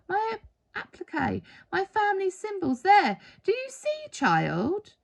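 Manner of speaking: a hint of a frail old lady pointing things out; old woman. A narrator's reading. Old woman shows her symbols.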